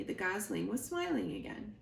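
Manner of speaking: usual speaking voice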